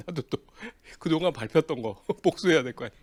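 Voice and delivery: amused, laughing through the revenge. Real, not acted. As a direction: tell it laughing at your own payback. Laughs about getting revenge.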